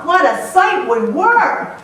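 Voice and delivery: usual speaking voice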